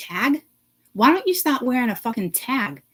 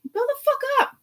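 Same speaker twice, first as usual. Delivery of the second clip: exasperated. Real, not acted. Rant outburst.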